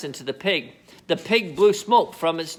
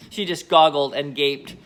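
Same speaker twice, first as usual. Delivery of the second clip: plain reading voice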